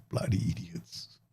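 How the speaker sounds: under-the-breath whisper; whispered insult. Real, not acted. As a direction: hiss it unvoiced to yourself. Muttering at his players.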